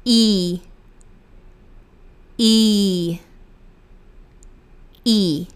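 "I" as a drawn-out pure ee; E as Brazilian I. Shown as a demonstration. Teacher drilling English letter E, long ee sound. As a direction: say "I" like Brazilian Portuguese i, held. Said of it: "tem a pronúncia do nosso I brasileiro".

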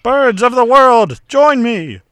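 loud, high, slow villain proclamation; rally the birds like a cartoon villain; villain cry. Voicing the falcon as villain.

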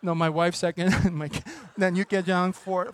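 ordinary delivery

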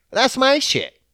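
hammed-up triumphant boast; proud boast. Shown as a demonstration. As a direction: crow it out, proud of yourself. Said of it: "says it twice, second bigger".

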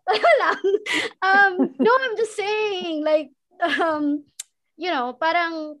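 high, laughing through words after a fit; laughter still in the voice. Real, not acted. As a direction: talk while the giggles fade.

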